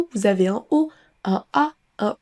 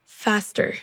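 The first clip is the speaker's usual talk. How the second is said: American accent; 4 dB quieter than usual. Shown as a demonstration. Drill alternates American and British.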